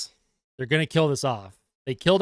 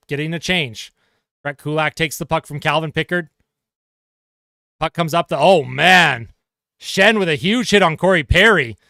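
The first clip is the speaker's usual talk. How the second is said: brisk hockey play-by-play call; sportscaster calling the action; 2 semitones higher, 4 dB louder than usual. Acted. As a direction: call the game live as it happens. Live play-by-play of hockey game.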